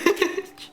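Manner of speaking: short high giggle; real laugh. Real, not acted. Vlogger joking English is not her first language, giggle. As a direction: giggle at your own joke.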